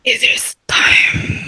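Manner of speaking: slow, breathy whisper for mock doom; drawn-out Nosferatu-style whisper; creepy vampire whisper. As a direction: whisper it slowly and ominously. Echoes co-host in Orlok voice.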